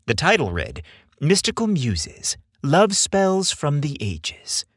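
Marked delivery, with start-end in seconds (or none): none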